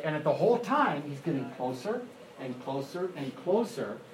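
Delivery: usual speaking voice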